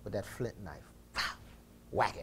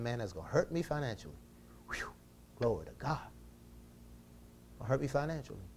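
ordinary talk

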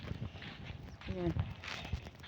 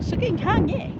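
very high, mock-dramatic cry; high-pitched joking wail about the wind; playful wind cry; 11 semitones higher, 4 dB louder than usual. Exclaiming about wind, third time.

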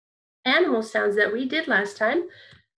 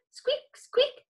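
very high little squeaks; very high mouse squeaks; 14 semitones higher than usual. Shown as a demonstration. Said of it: "So you're gonna go squeak".

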